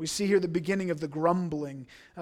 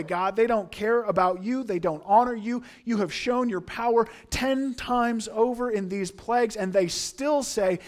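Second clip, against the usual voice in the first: louder, higher urging to God; impassioned raised appeal; urgent plea; 4 semitones higher, 6 dB louder than usual. Acted. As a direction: press God to give up on them. Voicing imagined plea to God.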